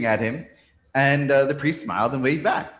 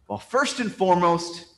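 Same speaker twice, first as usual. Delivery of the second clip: higher-pitched lead-in, held at normal volume; measured objection; 5 semitones higher than usual. Acted. Man starts answering the priest's question, measured objection. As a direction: begin the objection with restraint, pitched up. First, restrained take of man's answer.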